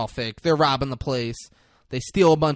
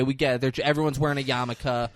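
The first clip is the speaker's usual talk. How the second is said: usual speaking voice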